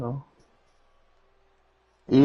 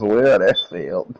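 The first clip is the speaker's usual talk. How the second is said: deadpan quote; 4 semitones lower than usual. Retold or imitated. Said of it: "he told us".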